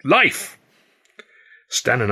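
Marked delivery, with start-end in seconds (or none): 0.0-0.6 a commanding police chief, raised pitch; police chief order. A narrator's reading. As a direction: bark the order like a pompous chief.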